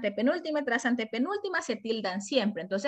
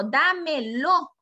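syllable by syllable; 3 semitones higher, 4 dB louder, much slower than usual. Shown as a demonstration. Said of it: "le pongo otro y digo".

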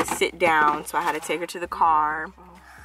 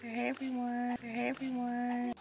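a soft, slightly higher everyday hey; imitating her real voice; 6 semitones higher, 12 dB quieter, slower than usual. Mother imitates daughter's real voice, softly. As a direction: show how she really greets, softly. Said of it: "That's not how she talks".

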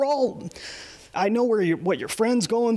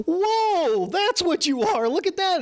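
amazed whoa; 11 semitones higher than usual. Retold or imitated. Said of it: "I'm like".

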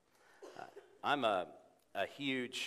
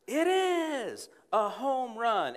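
high, slow drawn-out announcer call; 11 semitones higher, 7 dB louder, much slower than usual. Retold or imitated. Preacher imitating a baseball announcer calling a homer. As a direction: bellow it like a ballpark radio announcer.

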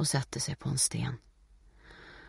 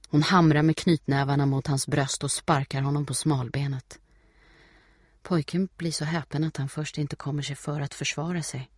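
ordinary delivery

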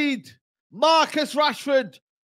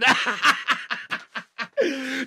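quiet laughing; laughter; 4 semitones lower, 6 dB quieter than usual. Real, not acted. Fan laughing at his teasing. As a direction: chuckle along.

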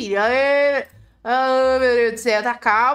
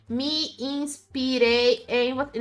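ditando devagar; 3 dB quieter, much slower than usual. Real, not acted. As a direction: stretch each word as if typing it. Dita mensagem que digita no chat. (ditando bem devagar enquanto digita)

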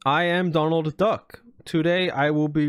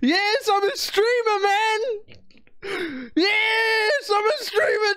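much higher, shouting and cracking; ecstatic mock celebration; 13 semitones higher than usual. Streamer celebrating being part of Minecraft YouTube. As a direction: scream it like you just won.

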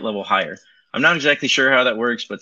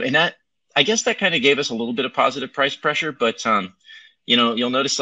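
ordinary talk, nothing marked

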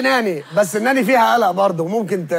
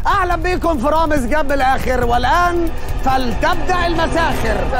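announcer voice; 5 semitones higher than usual. Acted. Host's announcer opening.

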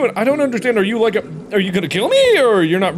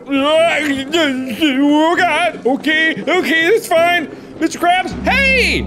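high-pitched, frantic exclamations; panicked; 9 semitones higher, much slower than usual. Real, not acted. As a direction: call out in mounting panic. Fleeing a game monster.

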